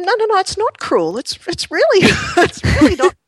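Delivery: high, loud, laughing denial; laughing protest. Retold or imitated. Mum laughingly insisting to her son it's not cruel, high and loud. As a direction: protest brightly, breaking into laughs.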